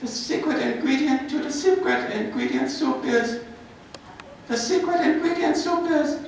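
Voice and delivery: high-pitched film character voice; put-on high voice, solemn reveal. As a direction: deliver it high, like revealing a great secret. Performs a film character's line.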